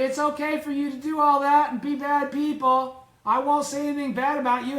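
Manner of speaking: high, loud, easygoing voice for the lenient bodhisattva; breezy, anything-goes tone; mild mock permissive voice. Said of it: "never disparaging would just constantly say".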